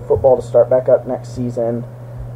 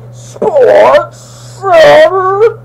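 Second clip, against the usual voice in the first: much higher, louder, very slow drawn-out yell; hammed-up battle cry; 13 semitones higher, 7 dB louder, much slower than usual. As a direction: blast it out like a pumped-up hype cry. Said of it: "that was me harnessing athletic energy".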